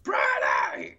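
mock-furious strangled yell; hammed-up exploding in anger; angry shout. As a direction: imitate finally snapping and yelling the name. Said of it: "Brainy makes this dude angry".

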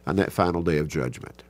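usual speaking voice

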